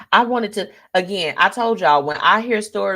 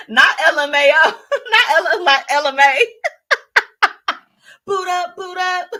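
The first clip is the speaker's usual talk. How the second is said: high, helpless laughter through the words; cracking up; 9 semitones higher, 3 dB louder than usual. Real, not acted. Host reads viewer's comment, cracking up. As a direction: try to get it out while laughing.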